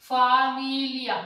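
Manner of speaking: फामिलिया drawn out slowly, loud, fa-mi-li-ya; slow pronunciation demo. Shown as a demonstration. Teacher repeating फामिलिया loudly for students. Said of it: "ये बन गया फामिलिया".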